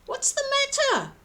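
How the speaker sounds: high, gentle caring question; concerned giant. A narrator's reading. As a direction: ask kindly, voice lifted high. George asks the goat.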